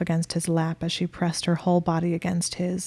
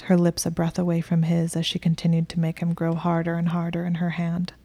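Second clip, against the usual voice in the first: ordinary delivery, nothing marked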